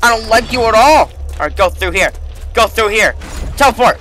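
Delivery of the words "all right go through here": ordinary talk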